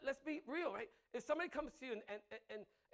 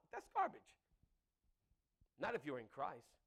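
ordinary talk

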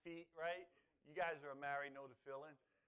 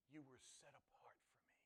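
soft unvoiced whisper; 3 semitones lower, 18 dB quieter than usual. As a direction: breathe it like a secret to the bride. Whispered line of God to bride.

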